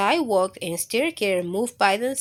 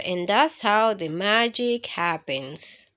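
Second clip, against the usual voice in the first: ordinary delivery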